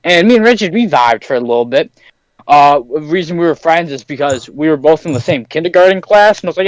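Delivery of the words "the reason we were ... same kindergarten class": ordinary talk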